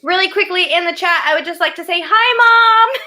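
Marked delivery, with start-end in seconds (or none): 2.0-3.0 excited. Real, not acted. Greeting mom in chat.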